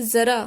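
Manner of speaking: "जरा" as zaraa, starting on a buzzing z; teacher's emphatic zaraa for "जरा"; z-sound demo. Shown as a demonstration. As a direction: model "जरा" loudly with z, not j. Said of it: "यहाँ पर ज की आवाज़ आ रही है".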